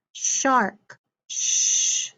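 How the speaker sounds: "shark" then a long voiceless "sh" alone; sound card drill. Shown as a demonstration. Teacher drilling sound cards with the class, first sound held. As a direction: say "shark", then hold just "sh". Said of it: "get ready for our sound cards".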